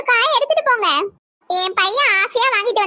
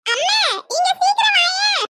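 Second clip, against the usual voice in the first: high, urgent call across the house; excited calling out; 7 semitones higher than usual. Acted. Daughter calls mother to see who has come, excited calling out. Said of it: "எதுக்கு கத்துற?".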